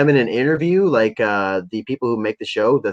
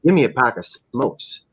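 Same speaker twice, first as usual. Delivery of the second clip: mock menacing order. Fan imitating a dealer pointing to his gun, understated menacing demand.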